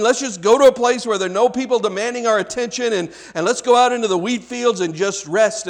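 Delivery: usual speaking voice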